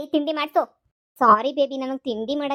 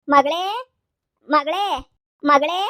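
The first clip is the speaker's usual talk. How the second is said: calling out; 5 dB louder, slower than usual. Acted. Mother calls her daughter.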